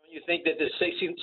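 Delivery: usual speaking voice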